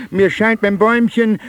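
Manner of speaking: ordinary talk, nothing marked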